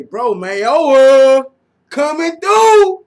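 slow, loud, high drawn-out hype; excited callout. Real, not acted. Fan hyping Mayowa's rush, slow and loud. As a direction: stretch the name out in excitement.

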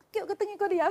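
high, laughing voice; amused retelling. Retold or imitated. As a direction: blurt it out high, breaking into laughter. Retells master's order with laughter.

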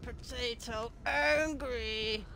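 slow, drawn-out monster voice; angry potato creature. Acted. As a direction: speak as a lumbering angry creature.

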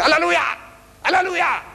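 high-pitched mock cry of fervour; imitated congregational shout. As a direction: call it out like an excited crowd. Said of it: "You know what you're shouting?".

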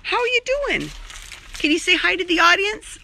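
very high pet voice. Real, not acted. Baby-talking the cat.

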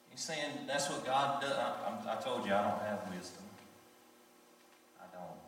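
ordinary delivery

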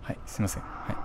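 unvoiced whispered sorry; ひそひそ声で謝る; hushed apology. Real, not acted. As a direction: whisper an apology after being told off. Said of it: "あ、うるさかったですか？".

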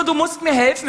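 a hint of a needy best friend pleading; pleading girlfriend. Friend Britney pleads, voiced quote.

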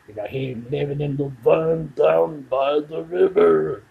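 slow, loud motivational-speaker bellow; Matt Foley impression. Quoting Matt Foley to the viewer in a slow loud bellow. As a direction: boom it out slowly like a frantic coach. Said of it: "Chris Farley as Matt Foley".

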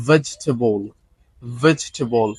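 vegetable in three syllables, VEJ-te-bol; correct pronunciation. Shown as a demonstration. Teacher models word four, correct pronunciation. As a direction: skip the vowel after the j in vegetable. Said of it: "not vegetable".

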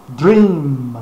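dream with a popped D and lips shut on the M; dream, one syllable; explosive D, closed M. Shown as a demonstration. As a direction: punch the D of dream, close the mouth for the M. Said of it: "um D explosivo e fechando a boca lá pro M".

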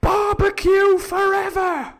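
mock crowd cry, very high and loud; sudden pirate cheer. Reader re-enacts the pirates' odd outburst, cheering. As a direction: burst out slowly in a screeched cheer. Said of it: "the pirates all just suddenly went".

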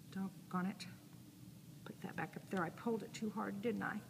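whispered to herself. Real, not acted. Tape tore, muttering to herself.

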